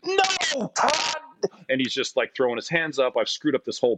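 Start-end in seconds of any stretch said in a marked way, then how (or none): 0.0-1.2 much higher, mock shouted name; exasperated coach yell. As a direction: yell at the player who's out of place. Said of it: "He's like".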